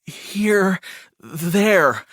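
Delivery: high, drawn-out, anxious repetition; shuddering. A narrator's reading. Frightened brother repeating to his brother, shuddering. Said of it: "Shep repeated with a shudder".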